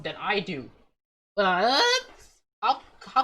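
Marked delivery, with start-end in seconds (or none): 1.3-3.2 high, loud startled what; shocked. Real, not acted. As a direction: blurt it in disbelief. Shocked at the note.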